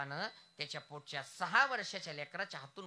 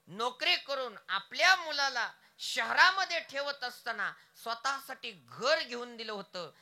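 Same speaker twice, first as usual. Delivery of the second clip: ordinary talk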